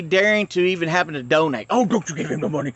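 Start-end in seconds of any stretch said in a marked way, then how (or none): 1.7-2.7 hammed-up scolding in a put-on voice, rushed at the end; mock angry warning, raised voice; mock cussing out. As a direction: imitate a man telling someone off. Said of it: "cussing them out for daring to talk to me".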